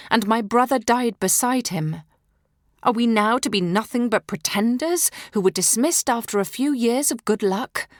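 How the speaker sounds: ordinary delivery, nothing marked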